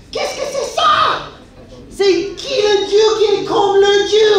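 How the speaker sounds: high, loud shout of disbelief; astonished shout. Retold or imitated. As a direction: shout it out, amazed and indignant. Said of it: "Ils ont dit". (cri stupéfait, aigu et fort)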